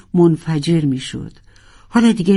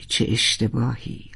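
softer, lower second lament; quieter regretful echo; 5 semitones lower, 7 dB quieter than usual. Acted. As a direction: let it fade into regret. (زمزمهٔ پشیمان)

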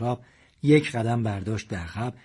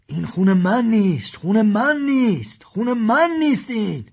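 panicked Madad; 10 semitones higher, 7 dB louder than usual. A narrator's reading. Madad panicking over the blood.

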